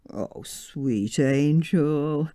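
tender. Acted. George tender to his wife.

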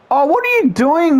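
high-pitched cry of frustration; exasperated scolding. Real, not acted. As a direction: cry out at your useless player. Scolds his player mid-match.

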